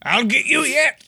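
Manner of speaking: high, hammy cartoon villain snarl; mustache twirling villain. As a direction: shake a fist like a melodrama baddie. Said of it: "twirls his mustache and goes".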